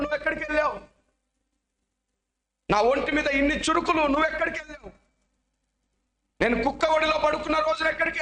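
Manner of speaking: put-on kneeling boy cries out to God, tearful and accusing; crying accusation. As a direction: plead with God through tears. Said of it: "మోకరించి ఏడుస్తున్నాడు".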